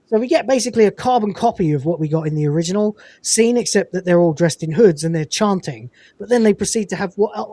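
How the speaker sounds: ordinary talk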